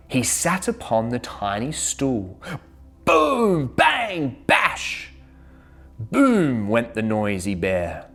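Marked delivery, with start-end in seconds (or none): 3.0-5.1 high punchy drum sounds; playful drum sound effects; drum noises. A narrator's reading. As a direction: bang out the drum words. Drum sounds read aloud.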